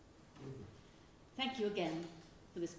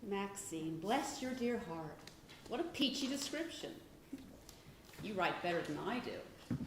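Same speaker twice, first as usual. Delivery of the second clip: ordinary talk, nothing marked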